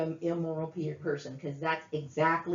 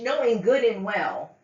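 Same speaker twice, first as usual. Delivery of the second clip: ordinary talk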